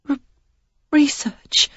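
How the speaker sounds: breathless stammered whisper, high; frightened whisper, stammering on the first syllable. Acted. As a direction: she can barely get the word out. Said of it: "the one stammering word".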